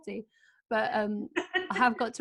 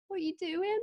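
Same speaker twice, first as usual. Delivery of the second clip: higher, prying friend voice; nosy friends; 9 semitones higher than usual. Retold or imitated. Friends with their nose over the fence, prying and high. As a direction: lean over the fence and pry. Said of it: "go like".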